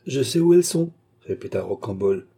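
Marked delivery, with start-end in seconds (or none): 0.0-0.9 high, loud, confident announcement; bold claim. A narrator's reading. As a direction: call it out to be heard. Boy repeats his bold claim.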